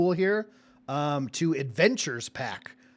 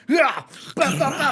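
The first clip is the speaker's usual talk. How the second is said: high, loud burst of fight noises; acted battle cry; 10 semitones higher, 4 dB louder than usual. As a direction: leap in like Batman, punching out each hit. Acting out Batman leaping into thugs.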